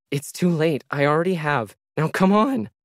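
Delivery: now come on, pleading hard; desperate woman dragging a robot to the exit; urgent pleading. A narrator's reading. Mariah urging robot to leave.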